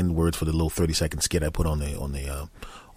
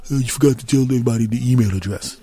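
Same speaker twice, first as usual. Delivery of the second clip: quick, higher nagging listener voice; mock listener's complaint; 4 semitones higher, faster than usual. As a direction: put on a fussy listener butting in. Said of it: "Somebody goes".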